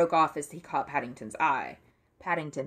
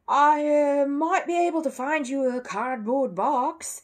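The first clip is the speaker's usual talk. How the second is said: much louder and higher, mock-generous sneer; sarcastic offer; 9 semitones higher, 7 dB louder, slower than usual. A narrator's reading. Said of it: "he said sarcastically".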